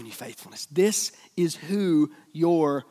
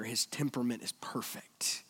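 whisper; 4 semitones lower, 11 dB quieter than usual. Real, not acted. Hushed sermon emphasis.

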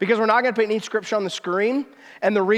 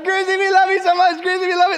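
put-on excited kids; 13 semitones higher, 6 dB louder than usual. Kids cheering to dog on speakerphone.

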